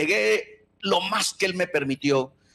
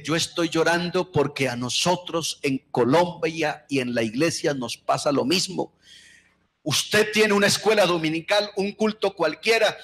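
ordinary talk, nothing marked

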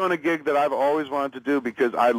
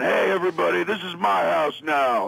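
mock home intruder; 6 semitones higher than usual. Imagined intruder announces he owns the house, mock intruder voice. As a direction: play a hammed-up intruder claiming the place. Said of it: "I could be the dude that was in there being".

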